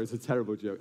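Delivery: ordinary delivery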